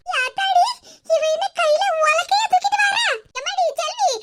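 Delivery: shocked exclamation; alarmed surprise. Acted. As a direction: gasp it out at the pestle. Sees daughter bring a pestle.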